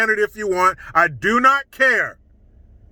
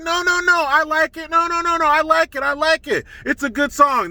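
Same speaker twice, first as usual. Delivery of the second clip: high mock-enthusiastic impression of Maxine Waters; imitating Maxine Waters; 7 semitones higher than usual. Commentator mimics Maxine Waters gushing over the song, high mock enthusiasm. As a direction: gush it in a raised mock voice, insisting. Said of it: "She's like".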